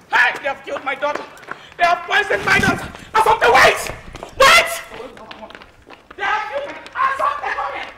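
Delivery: a mother calling desperately for help after her daughter is poisoned; panicked calling out. Acted. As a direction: scream for her as if she is dying.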